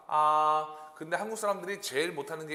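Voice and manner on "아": "아" held long, high and level; first tone. Shown as a demonstration. Teacher leading learners to repeat, high level tone. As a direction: sing out "아" on one steady high note. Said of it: "일 성".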